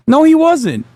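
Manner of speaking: high, sneering denial; mocking no. Streamer scoffing at the chat, high sneering denial.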